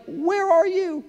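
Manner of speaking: high, stretched-out plea as an estranged spouse; exasperated cry. Retold or imitated. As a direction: cry it out high across the distance. Acted quote of estranged older couples.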